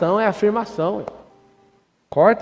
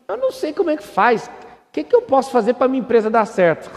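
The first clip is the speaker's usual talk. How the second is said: much higher, louder helpless whine; mock clueless asker; 8 semitones higher, 6 dB louder than usual. Mocking a business owner asking for help, high loud whine. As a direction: squeal it like a lost beginner. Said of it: "Se você perguntar assim".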